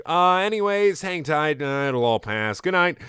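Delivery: high, casual sign-off; awkward phone-guy goodbye; Scott phone message. Acted. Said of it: "Scott message".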